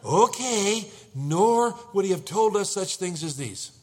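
loud, high, drawn-out okay, then insistent; exasperated wife. Acted. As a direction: insist to a husband who still doubts. Acted exasperated wife answering doubting husband.

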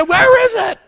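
very loud, high shout; urgent demand. Acted. King eager to hang Haman, shouting his demand. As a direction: snap out the question at full volume.

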